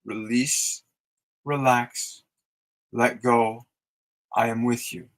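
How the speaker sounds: soothing mantra. Shown as a demonstration. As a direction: speak it kindly to your own sadness. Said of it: "Think and speak those words".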